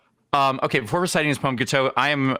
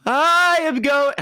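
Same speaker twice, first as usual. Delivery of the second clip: attempted falsetto; 10 semitones higher, 4 dB louder, much slower than usual. Shown as a demonstration. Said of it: "which I will attempt for you in this moment".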